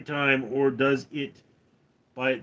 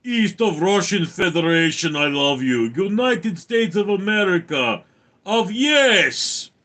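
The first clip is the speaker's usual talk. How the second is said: mock Russian accent; 4 semitones higher, 4 dB louder than usual. Mock Russian-style voice bit.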